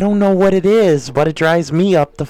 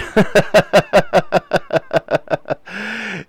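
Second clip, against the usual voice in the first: laughing; 4 semitones higher than usual. Real, not acted. Laughing at a chat message.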